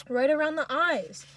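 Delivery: emphatic echo of a shout; appalled outcry. A narrator's reading. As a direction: cry it out in horror. Narrator echoes the cruel shout.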